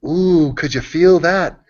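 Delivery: milk the awkward pause like a stand-up; imitating Jimmy Jeffries. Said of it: "And he goes".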